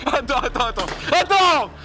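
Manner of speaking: high, pleading panic; panicked begging. Real, not acted. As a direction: beg him to wait, half laughing. Scared of being shot, begging. (supplication paniquée et aiguë)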